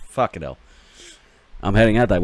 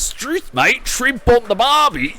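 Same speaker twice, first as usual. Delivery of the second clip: slow hammed-up Aussie accent, pitched high; mocking Aussie accent; 13 semitones higher, 3 dB louder, slower than usual. Aussie streamer mocks TV Australian slang in a broad Aussie accent. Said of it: "every fucking second person says".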